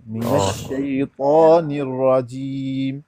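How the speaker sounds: الشیطان with a heavy ta, slow and drawn out; careful slow recitation. Shown as a demonstration. Teacher repeats the verse with the corrected ta. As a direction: recite slowly, ta heavy but no w. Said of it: "تا مفخم".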